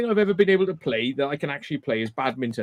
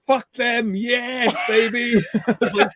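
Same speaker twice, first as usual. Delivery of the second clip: usual speaking voice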